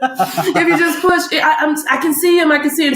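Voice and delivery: high, eager encouragement; excited urging. Retold or imitated. Joseph seeing the baby arrive, excited urging. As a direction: repeat it eagerly, pitch lifted.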